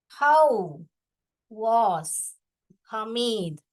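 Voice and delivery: slow, one word at a time with pauses; spaced-out classroom dictation; dictating. Real, not acted. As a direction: dictate word by word for pupils to write. Teacher dictating question word by word.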